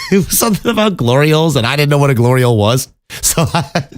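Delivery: high, fast words squeezed through real giggles; real laughing fit breaking into the sentence; talking through laughter. Real, not acted. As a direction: try to get it out while cracking up. Cracks up recalling the audition post.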